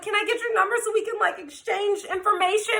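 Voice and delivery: high, hurried mock girl voice; imitating the girlfriend. Imitating rival girl's request.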